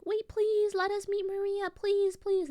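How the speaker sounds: high, pleading whine, imitating the visitors; mock pleading. The visitors begging Patrick at the door, high pleading whine. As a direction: beg in a squeaky high voice.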